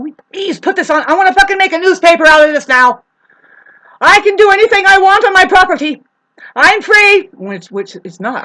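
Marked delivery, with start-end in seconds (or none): 0.0-3.0 loud, high mocking rant; mock the cops, shouting; sarcastic. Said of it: "I'm being sarcastic right there". 4.0-6.0 loud, high defiant mock yell; sarcastic shout. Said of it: "I'm being sarcastic right there". 6.6-7.3 loud, high mocking cry; sarcastic. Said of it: "I'm being sarcastic right there".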